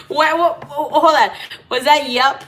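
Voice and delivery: loud, laughing what-what-what, caught off guard; startled disbelief. Real, not acted. She gasps at the card she just pulled, startled and laughing. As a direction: stop short in real surprise.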